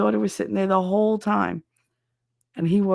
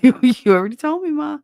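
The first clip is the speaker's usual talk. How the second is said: loud, higher-pitched protest to mother; exasperated; 9 semitones higher, 8 dB louder than usual. Retold or imitated. Daughter telling mother off for repeating stories, exasperated. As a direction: push back, fed up and louder. Retold quarrel with repetitive mother.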